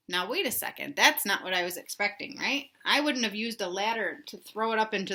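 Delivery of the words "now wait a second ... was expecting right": usual speaking voice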